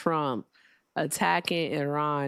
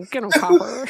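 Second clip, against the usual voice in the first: loud, high words through laughter; laughing; 7 semitones higher, 4 dB louder than usual. Real, not acted. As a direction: cheer it while laughing. Laughing at the caller.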